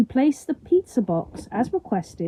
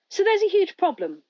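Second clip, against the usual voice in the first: high-pitched, hesitant stranger's voice; loudspeaker stranger; 10 semitones higher than usual. A narrator's reading. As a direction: break bad news in a higher, tentative voice. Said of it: "the person continues".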